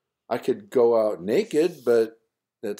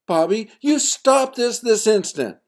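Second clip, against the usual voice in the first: high, loud motherly order; stern command; 13 semitones higher, 8 dB louder than usual. Acted. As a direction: snap him back into line. Mother demanding son reappear.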